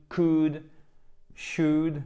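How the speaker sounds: "could", "should" spaced out with no L; silent L. Shown as a demonstration. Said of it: "Pas de L". (« could, should » prononcés sans le L)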